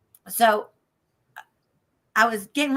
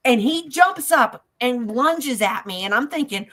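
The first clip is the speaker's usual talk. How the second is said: ordinary talk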